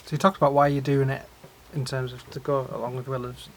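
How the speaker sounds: ordinary talk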